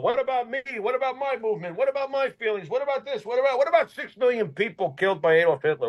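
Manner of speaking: high-pitched mock protest of self-centred people; mock complaining. Mimicking people who demand attention.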